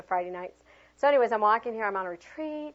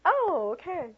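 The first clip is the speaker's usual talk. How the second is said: embarrassed; 9 semitones higher, 5 dB louder, slower than usual. Retold or imitated. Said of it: "I was totally embarrassed".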